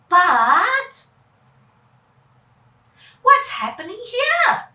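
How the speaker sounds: high, loud puzzled question; spring a new surprise; surprised. Acted. New mystery.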